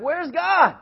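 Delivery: high, loud questioning call; desperate outcry. Retold or imitated. Israelites feel God ignores them, high desperate cry. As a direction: cry out as if abandoned.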